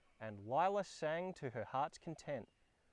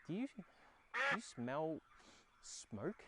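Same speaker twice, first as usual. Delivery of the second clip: ordinary delivery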